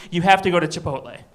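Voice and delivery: ordinary delivery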